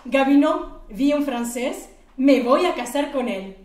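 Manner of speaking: giddy yelling to her boss that she'll marry him; excited shout. Acted. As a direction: blurt it out overjoyed. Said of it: "elle dit".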